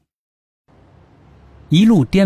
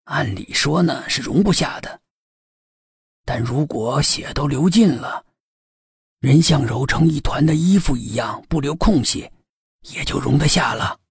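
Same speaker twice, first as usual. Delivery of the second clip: put-on old police chief; 2 semitones lower than usual. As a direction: answer like a seasoned old cop.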